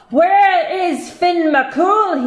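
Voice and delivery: slow, loud, high giant bellow; giant shouting. Acted. Scottish giant shouts at Una in the house. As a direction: bellow it slowly. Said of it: "he shouted".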